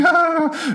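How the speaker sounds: very high-pitched, crazed "ah"; mock insane wail. Fred losing his mind over shoes, high mock wail. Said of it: "he just goes insane".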